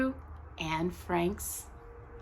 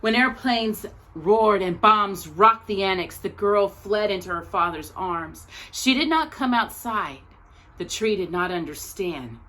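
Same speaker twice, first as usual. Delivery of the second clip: measured, expressive picture-book reading; story narration. Acted. Teacher reading a picture book about Anne Frank's tree to her class, gentle storytelling. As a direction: read it like a storyteller at circle time.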